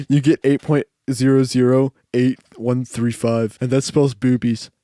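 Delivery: put-on math geek reciting digits; nerd voice. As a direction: recite it as a dorky know-it-all. Acts out mathcore nerd's number joke.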